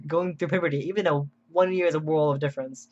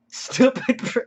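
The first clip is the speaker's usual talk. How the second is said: mock comment reading; 9 semitones higher, 5 dB louder, much slower than usual. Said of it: "I don't know what they mean".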